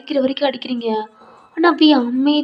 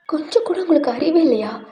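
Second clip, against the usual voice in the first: higher, louder, fast scolding; angry scolding; 5 semitones higher, 3 dB louder than usual. Acted. Teacher scolding the noisy first years, shouting angrily. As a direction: tear into the noisy class.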